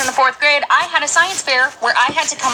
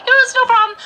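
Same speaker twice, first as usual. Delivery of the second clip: much higher, louder cheerful line; bright loud reassurance; 11 semitones higher, 8 dB louder, faster than usual. Retold or imitated. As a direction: sing it out overly cheerful. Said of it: "So I was like".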